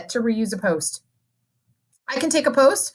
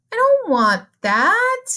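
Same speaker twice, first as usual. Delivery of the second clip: high, slow mock disgust; sarcastic disgust; 10 semitones higher, much slower than usual. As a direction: fake horror at the mess. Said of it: "I'm hoping you're getting my sarcasm".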